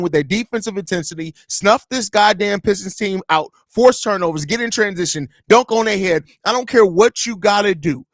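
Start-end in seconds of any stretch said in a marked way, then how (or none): none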